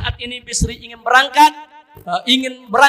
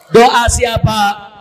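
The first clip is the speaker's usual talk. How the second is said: "Doa siapa?" stretched out very slowly and loud; "Doa siapa?" drawn out slowly for weight; slow drawn question; 7 dB louder, much slower than usual. Real, not acted. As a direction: let "Doa siapa?" hang, word by word. Same question repeated, drawn out.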